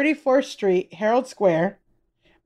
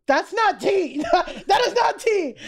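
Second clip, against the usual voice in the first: high, indignant protest breaking into a laugh; mock outrage; 6 semitones higher than usual. Retelling her reply to a friend's weak gossip, mock outraged. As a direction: reject it in rising, laughing disbelief.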